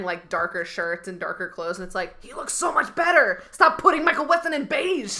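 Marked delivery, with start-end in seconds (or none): 2.2-5.2 high, loud mock outrage; hammed-up exasperated complaint, pitched high; exasperated outcry. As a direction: plead loudly with the costume department. Mock outrage at show's costume choices.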